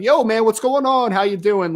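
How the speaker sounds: high, loud, easygoing hey-man call; casual buddy greeting. Retold or imitated. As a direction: greet a recruit like an old pal. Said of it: "be like".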